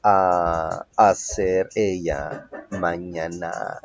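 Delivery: very slow, drawn-out translation. Shown as a demonstration. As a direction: stretch each word for learners. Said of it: "teacher translates slowly". (traducción muy lenta y alargada)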